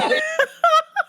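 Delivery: high cackle; high cackling laugh. Real, not acted. Comic laughing at her own roast, high cackle. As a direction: break into a cackle.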